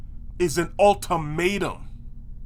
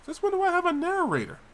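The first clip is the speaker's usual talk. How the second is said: indignant disbelief; 10 semitones higher, much faster than usual. Acted. Indignant at unexpected narrator.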